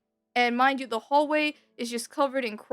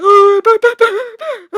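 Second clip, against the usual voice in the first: big sharp gasp for air, high and loud; imitated gasp; 7 semitones higher, 14 dB louder than usual. Kid pulled from holy water gasping for air. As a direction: gulp air like you just got pulled out of the water. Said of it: "gasping for air".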